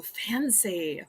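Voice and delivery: breathy impressed whisper. Real, not acted. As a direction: hush it, impressed. Reacting to designer purses.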